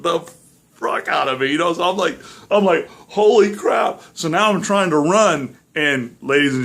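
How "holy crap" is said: shocked exclamation. Retold or imitated. Grabbed by something in hallway.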